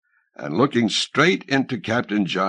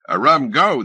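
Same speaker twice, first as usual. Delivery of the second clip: captain's voice; 5 semitones higher, 5 dB louder than usual. A narrator's reading. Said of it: "said Captain Johns".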